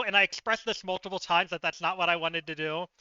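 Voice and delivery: ordinary talk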